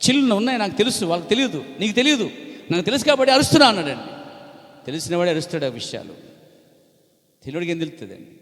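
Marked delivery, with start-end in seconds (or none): 0.1-3.7 urgent prophet shout. Retold or imitated. Prophet explains why he shouts.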